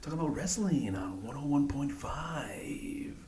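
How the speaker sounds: soft, slow late-night radio host; radio station voice. Shown as a demonstration. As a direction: croon it like a nighttime DJ. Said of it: "give me your radio station voice again".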